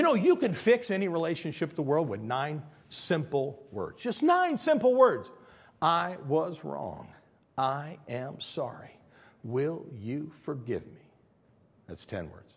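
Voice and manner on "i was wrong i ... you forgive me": word by word, at half speed; slow, measured apology. Shown as a demonstration. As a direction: spell out the apology slowly, without shouting. First take of the simple words.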